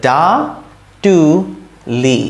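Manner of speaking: ডা, টু, লি with a long pause after each; syllable by syllable. Shown as a demonstration. Teacher building a word for learners, syllable by syllable. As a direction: separate the three sounds completely. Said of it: "তাহলে কি হতো".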